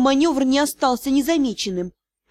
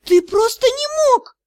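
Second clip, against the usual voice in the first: much higher, slower, strained wail; anguished moan; 12 semitones higher, 4 dB louder than usual. A narrator's reading. Rejected lady collapsing before the lord, anguished wail. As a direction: cry out in disbelief and pain. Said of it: "простонала она".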